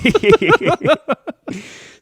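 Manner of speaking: high, loud, helpless laugh; burst of laughter. Real, not acted. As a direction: crack up loudly at the joke. Bursts out at medical bill joke.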